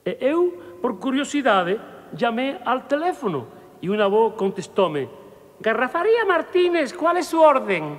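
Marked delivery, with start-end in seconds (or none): 5.6-8.0 high, brisk shopkeeper voice on the phone; phone answerer. Acted. Liquor shop clerk answering a drunk caller, high and brisk.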